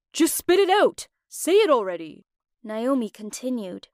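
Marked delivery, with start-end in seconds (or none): 0.1-2.2 loud, high angry snap; angry outburst. A narrator's reading. Mark yelling at Naomi to spit it out, angry shout. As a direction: demand it at full volume, fed up. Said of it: "Mark got mad and said".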